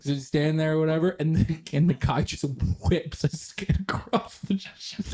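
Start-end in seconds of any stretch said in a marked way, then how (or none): 2.9-5.1 slow, voiceless wheeze of laughter; laughing too hard to voice it; helpless laughter. Real, not acted. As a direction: squeeze the words out while cracking up. Cracking up while telling the story.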